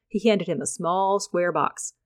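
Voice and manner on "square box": plain reading voice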